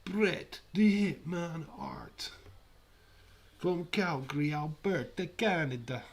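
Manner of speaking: loud, high mock-Transylvanian ring announcement; Transylvanian accent. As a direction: announce it like a hammy Transylvanian count. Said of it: "Transylvanian accent".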